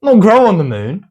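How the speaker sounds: high, loud protest; indignant arguing. Real, not acted. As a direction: argue it loudly. Arguing the answer.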